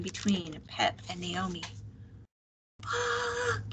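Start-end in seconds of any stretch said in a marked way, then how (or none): 2.8-3.7 very high, held-back shout. A narrator's reading. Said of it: "he shouted".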